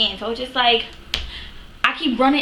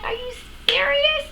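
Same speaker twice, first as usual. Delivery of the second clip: "Are you serious?" leaping much higher in mock shock; shocked disbelief. Retelling her reaction to the broke date, high shocked disbelief.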